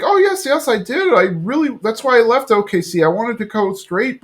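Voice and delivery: high-pitched, earnest excuse; pleading imitation. Tammy explaining herself to Peggy, high pleading. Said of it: "She's like".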